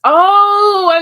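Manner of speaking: loud, high, drawn-out "oh"; big dawning-understanding exclamation; sudden realisation. Real, not acted. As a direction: let the penny drop loudly.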